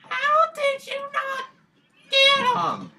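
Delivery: mock squeaky disbelief; squeaky high voice. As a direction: squeal it like a cartoon. Squeaky voice mocking failure.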